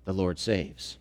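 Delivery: a reverent near-whisper; low and hushed. Real, not acted. Quiet repetition of the name's meaning.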